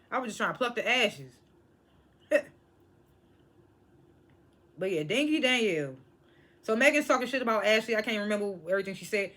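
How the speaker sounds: usual speaking voice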